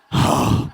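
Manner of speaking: breathy mock exhausted exhale; hammed-up tired sigh; weary sigh. Mock weariness after joke.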